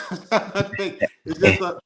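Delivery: usual speaking voice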